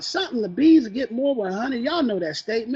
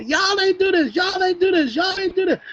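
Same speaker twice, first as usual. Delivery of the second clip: higher, repeated nagging complaint; sing-song the blame over and over; mock hating leader; 7 semitones higher than usual. Leader mocks a hateful boss blaming the team, nagging. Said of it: "Just hating on the people".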